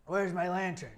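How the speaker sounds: usual speaking voice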